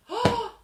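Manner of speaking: high, loud startled gasp; loud shocked gasp. Acted. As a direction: gasp in fright. Goldilocks wakes to bears.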